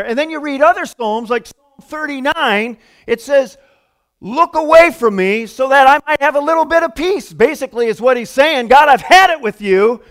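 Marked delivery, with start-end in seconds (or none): 4.2-7.3 loud, high, fed-up outburst; worked-up, raised-voice complaint to God; exasperated plea. Acted. 8.7-10.0 loud, raised, exasperated complaint; hammed-up exasperation at full volume; fed-up outburst. Paraphrases psalmist fed up.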